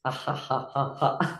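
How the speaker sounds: soft, deliberate ha-ha-ha; quiet laughter in even beats; exercise laughter. Shown as a demonstration. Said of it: "little shake with laughing".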